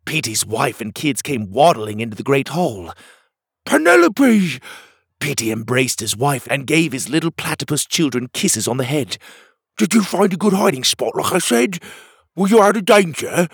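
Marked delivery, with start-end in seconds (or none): 3.7-4.6 high, loud cry of the name; excited high-pitched shout; calling out. Acted. Petey calls to his reunited wife. 9.8-13.5 higher, eager questioning voice; high-pitched worried dad character; concerned platypus father. Acted. Voices Petey questioning his family.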